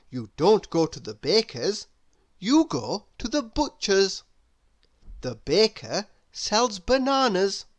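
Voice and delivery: plain reading voice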